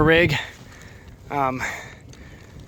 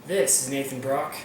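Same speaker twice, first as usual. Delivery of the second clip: news anchor; 6 dB quieter, slower than usual. Acted.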